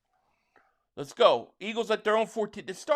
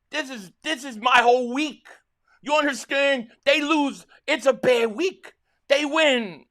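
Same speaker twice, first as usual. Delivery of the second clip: louder and higher, slowing on "it's a bad week"; worked-up fan; 5 semitones higher, 6 dB louder than usual. Real, not acted. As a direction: pour out how much it means. Fan explaining stakes excitedly.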